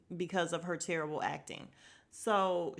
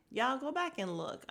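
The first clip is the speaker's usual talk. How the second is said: normal talking voice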